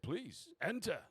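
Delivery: high-pitched servile invitation; lightly voiced fawning doorman; obsequious doorman. Doorman politely ushering noble inside.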